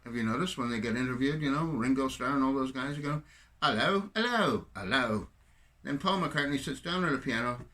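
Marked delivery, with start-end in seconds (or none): 3.6-5.3 Hello said as "ello", the H left off, slow; dropped H. Retold or imitated. Mocking how the Beatles greet interviewers, H dropped. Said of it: "they do not pronounce the letter H".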